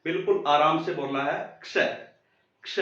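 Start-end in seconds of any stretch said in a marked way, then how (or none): 1.6-2.8 क्ष said gently, half क gliding into a stressed श; light k, stress sh. Shown as a demonstration. Hindi teacher to class: soft क्ष demo. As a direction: ease the क, push the श in क्ष. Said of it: "स पर जोर जाने दो".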